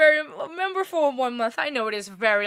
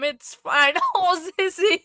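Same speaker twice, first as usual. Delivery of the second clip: normal talking voice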